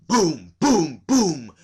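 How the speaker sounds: loud, high booming knock sounds. A narrator's reading. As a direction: hammer out each boom like a fist on a gate.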